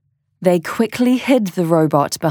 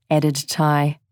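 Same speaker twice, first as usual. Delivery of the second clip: ordinary delivery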